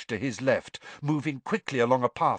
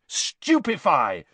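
mild shout; 3 semitones higher, 3 dB louder, slower than usual. A narrator's reading. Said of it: "and shouted".

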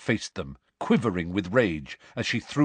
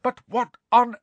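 high-pitched indignant argument; exasperated scolding; 13 semitones higher, 4 dB louder than usual. A narrator's reading. Professor dismissing pupils' excuse, high exasperated scolding. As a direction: pour scorn on their excuse.

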